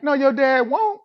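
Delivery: high, loud, panicked protest; panicked refusal. Retold or imitated. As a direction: cry out in alarm.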